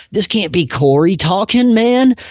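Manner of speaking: rising into a high, loud shocked cry; the pitch shoots up on man; shocked disbelief. Retold or imitated. Shocked at friend's words.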